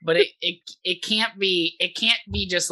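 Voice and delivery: ordinary talk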